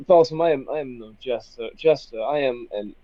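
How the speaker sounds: usual speaking voice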